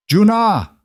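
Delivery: high loud call across the clearing. Acted. Sung Ho calling Kang Jun from the clearing, loud high call. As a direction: yell the name to stop them. Villain calls out to stop them.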